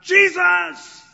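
piercing high shout; a blind beggar shouting the name of Jesus; cried out. Retold or imitated. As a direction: scream the name with everything you have. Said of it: "He cried out".

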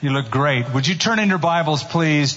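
normal talking voice

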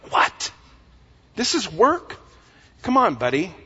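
mock disbelief, pitch jumping up; incredulous retort. Disciples answering religious leaders over Sabbath work, incredulous. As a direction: act out baffled protest at a silly rule.

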